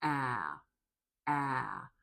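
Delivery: low-pitched, isolated "Ah" as in cat; open vowel demo. Shown as a demonstration. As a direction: drop the jaw really low for "Ah". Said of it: "drop your jaw really low".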